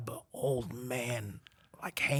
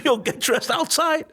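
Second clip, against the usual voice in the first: high, loud and slow through laughter; laughing command; 11 dB louder, slower than usual. Retold or imitated. Telling the bathers to leave, slow loud laughing order. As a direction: order them out while laughing. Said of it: "I told them".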